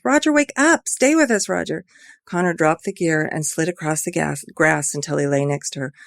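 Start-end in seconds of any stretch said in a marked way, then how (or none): 0.0-1.8 high, loud pleas to wake; desperate shouting. Acted. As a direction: shout him awake. Ivy screaming to wake him.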